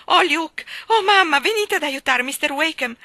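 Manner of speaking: loud, high desperate call; crying out. A narrator's reading. Daughter crying out for help to Luke and mother. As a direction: sob and shout for help. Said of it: "gridò Maggie piangendo".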